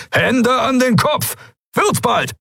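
very high, piercing order; yelled command. A narrator's reading. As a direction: yell it high and stretched, impatient for obedience. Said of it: "Der Befehl gellte durch den Hangar".

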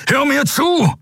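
very high, shouted command; urgent shout. A narrator's reading. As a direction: shout to make a struggling captive listen. Shouts at struggling captive.

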